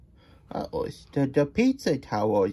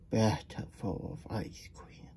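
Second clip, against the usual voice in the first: soft, slow whispery wonder; hushed awe; 3 semitones lower, 9 dB quieter, slower than usual. Real, not acted. Reader dreaming of ice cream, hushed wonder. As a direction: breathe it slowly, in awe.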